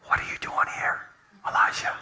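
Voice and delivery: God's voice in a breathy whisper. Acted. Pastor voicing God to Elijah, whispered. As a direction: whisper it like a still small voice. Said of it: "a voice from God said".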